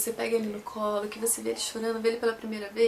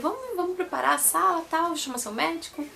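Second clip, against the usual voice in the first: higher, brisk nurse voice; nurse quote; 6 semitones higher than usual. Retold or imitated. Nurse tells the team to prepare the room, higher and brisk. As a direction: play the nurse giving quick orders. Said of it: "ela veio".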